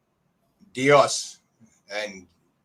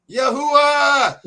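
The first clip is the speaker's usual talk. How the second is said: loud, high, drawn-out holler back; shouted reply; 12 semitones higher, 7 dB louder, much slower than usual. Retold or imitated. Brothers shouting back up at him, high holler. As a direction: shout it back, holding the end long. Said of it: "and they shouted".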